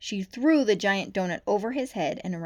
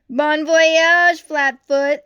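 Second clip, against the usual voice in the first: taunting; 8 semitones higher, 15 dB louder, much slower than usual. A narrator's reading. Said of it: "said the weasel with a chuckle".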